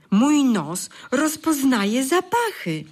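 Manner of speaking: high, slow, wondering proclamation; storybook elf overjoyed at his nose; joyful announcement. A narrator's reading. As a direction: announce the discovery with awe. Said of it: "zawołał".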